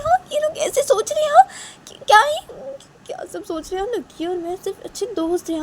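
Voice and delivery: high, bewildered daughter talking to herself; परेशान बेटी, अकेले में. Acted. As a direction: fret aloud alone, pitched well up. (अकेले में ऊँची आवाज़ में बड़बड़ाती परेशान बेटी)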